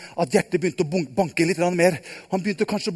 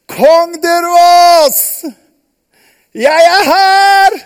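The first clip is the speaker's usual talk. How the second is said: very high, loud, slow call; calling up from below; 8 semitones higher, 10 dB louder, much slower than usual. Acted. Daniel answers the king from the den, slow loud call.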